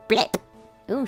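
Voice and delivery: ordinary talk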